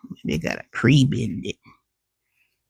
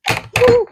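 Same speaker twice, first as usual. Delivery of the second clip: sudden high yelp from a burn; real yelp when hot glue hits; yelp of pain; 5 dB louder than usual. Real, not acted. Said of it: "How'd that piece of glue get me?".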